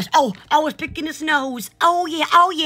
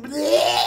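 low retching "bleh"; long, low fake vomit noise; mock puking. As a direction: imitate throwing up, deep in the throat. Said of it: "It's puking".

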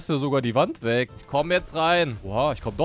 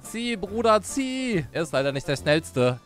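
ordinary delivery

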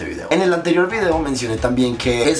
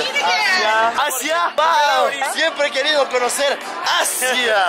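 excited; 13 semitones higher, much slower than usual. Acted. Jokes Mario heads to Asia.

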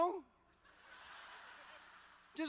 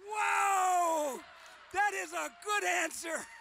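loud, higher delighted shout; excited; 6 semitones higher, 8 dB louder, slower than usual. Real, not acted. Cheering a fan who named his game, loud and delighted. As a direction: cheer the fan's answer.

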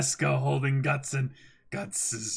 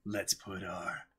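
hushed; 6 semitones lower, 4 dB quieter than usual. Acted. Ritual start of reading.

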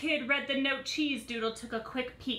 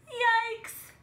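startled yelp, much higher; yikes; 12 semitones higher, 5 dB louder than usual. Acted. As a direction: squeak it out in fright. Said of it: "said Pretzel".

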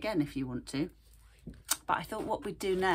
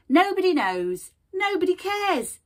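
high, loud, pushy shopkeeper rat; cheeky rat voice; 9 semitones higher, 11 dB louder than usual. Acted. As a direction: sell it like a brash little rat. Rat speaks in story.

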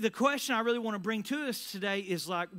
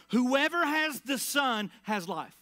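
slow, louder and higher, every word landed; emphatic declaration; 5 semitones higher, 5 dB louder, slower than usual. Acted. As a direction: deliver it like the final word. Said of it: "Mic drop".

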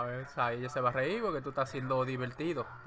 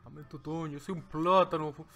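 slow, louder comic flirting with a strange put-on voice; hammed-up pickup line; 4 dB louder than usual. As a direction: ham up a cheesy street come-on. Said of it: "Ay, tú tiras el pi-, el tiropo".

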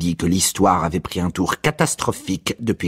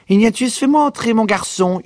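higher, louder mother's yell; mother yelling at the door, higher and louder; 6 dB louder than usual. A narrator's reading. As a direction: shout through the door to be let in. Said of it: "hurla-t-elle".

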